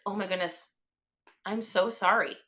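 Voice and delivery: softly imitated condolence, falling tone; a hushed, pitying stranger; mock pity. Repeats the strangers' pitying phrase.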